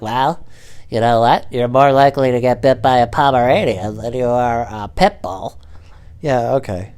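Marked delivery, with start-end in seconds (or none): 0.0-5.6 mocking imitation, put-on voice; know-it-all people. People defending pit bulls to him, mocked in a put-on voice. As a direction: parrot a smug dog defender. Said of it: "I hate when people are like".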